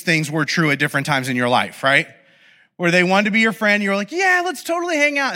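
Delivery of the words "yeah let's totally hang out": high-pitched, overly agreeable brush-off; fake agreement. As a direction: say yes brightly without meaning it. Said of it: "In the back of your head".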